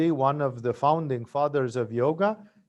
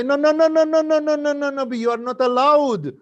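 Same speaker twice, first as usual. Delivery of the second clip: high, loud chorus of frantic no's; gods protesting; 11 semitones higher, 9 dB louder than usual. Retold or imitated. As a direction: cry out no over and over, much higher and louder. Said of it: "Brihaspati and Vishnu and Shiva say".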